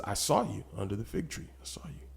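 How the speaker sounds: soft, sinking to a whisper; hushed awe. Acted. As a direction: let it fall to an awed whisper. Quiet, then a whispered exclamation.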